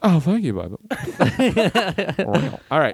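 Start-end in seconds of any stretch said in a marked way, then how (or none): 0.0-0.8 [name]. Retold or imitated. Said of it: "great [name]". 0.9-2.6 playful monster roar. Pretend. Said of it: "best monster".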